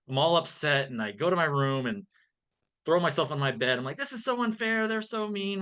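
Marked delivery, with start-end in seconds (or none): 4.0-5.6 put-on high, whiny child voice, a bit softer; sulky kid whine. Boy sulking to himself after scolding, whiny and high. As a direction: pout like a ten-year-old thrown on his bed.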